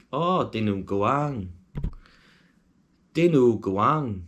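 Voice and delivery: slow, hammed-up try at a Filipino accent; attempted Filipino accent. Shown as a demonstration. Vlogger practising a hard dish name, bad Filipino accent. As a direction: draw each word out in an amateur Filipino accent. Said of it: "that's my impression of a Filipino accent".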